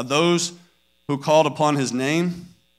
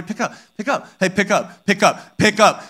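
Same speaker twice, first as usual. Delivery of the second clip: growing impatience; 3 semitones higher than usual. Retold or imitated. Parent repeating orders to kids at cleanup, growing impatient. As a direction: start gently and build with each repeat. Parent's retold patience running out.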